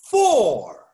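FOR said loud and strong; a loud, punched "For."; stressed word. Shown as a demonstration. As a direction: hit "For." hard to make them underline it. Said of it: "underline that word".

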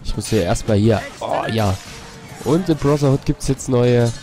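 normal talking voice

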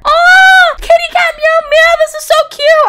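high, loud gushing over a kitten; delighted squeal. Real, not acted. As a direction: squeal at how cute it is.

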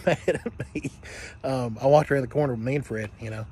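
ordinary talk, nothing marked

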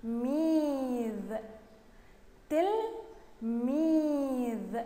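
تلميذ very slowly, تل then a long ميذ; slow syllabic reading. Shown as a demonstration. Teacher reading the next word with pupils, تلميذ slow and syllable by syllable. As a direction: stretch تلميذ in two held syllables. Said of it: "نقرأها مع بعض".

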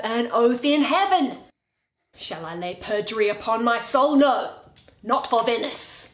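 ordinary talk

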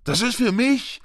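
put-on high, urgent boy's cry; calling out. A narrator's reading. Boy trying to snatch back his letter, high calling out. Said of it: "rief Harry".

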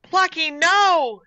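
high, loud, drawn-out cry. A narrator's reading. Gertrude seeing her cat bolt away, alarmed cry. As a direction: wail it out in alarm, stretching the words. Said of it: "she cried".

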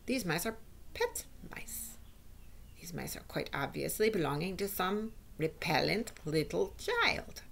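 lightly voiced witch, haughty and disgusted; indignant witch. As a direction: lightly play a witch disowning the mice. Voices the Grand High Witch.

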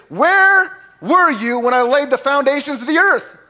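God's mocking challenge, pitched high. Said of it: "he's kinda mocking him right now".

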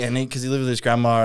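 ordinary talk

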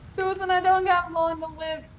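slow, high, loud hammed-up farewell; dying inmate. Inmate calling his wife before death, hammed-up farewell. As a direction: ham up a doomed prisoner on the phone.

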